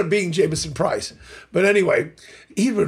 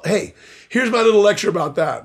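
usual speaking voice

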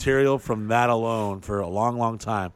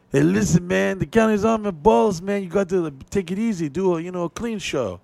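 higher, casual warning from a club boss; Mexican club owner; 8 semitones higher than usual. Retold or imitated. Club owner warning the comic about the county, pitched up. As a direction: lean in and tip him off, pitched up. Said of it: "club owner being like".

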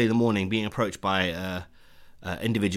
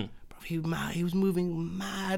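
whispering; 8 semitones higher, 6 dB quieter, slower than usual. Real, not acted. Confiding disapproval.

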